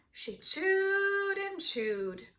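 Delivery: ordinary delivery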